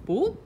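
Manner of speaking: squeaky high "boop" for perky ears; playful high-pitched boop. Retold or imitated. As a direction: pop the word up high and cute. Imitating the dog's ears.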